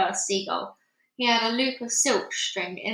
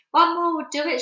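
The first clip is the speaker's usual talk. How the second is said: high, loud eager call; shouted; 7 semitones higher, 6 dB louder than usual. A narrator's reading. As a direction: urge them on. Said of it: "shouted the old green grasshopper".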